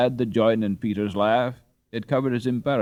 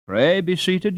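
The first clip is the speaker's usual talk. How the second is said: higher, louder motherly welcome; bustling hostess; 9 semitones higher, 6 dB louder, slower than usual. Acted. Mother welcoming rich boys into her cottage, eager and high. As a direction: fuss over guests like a busy old dame.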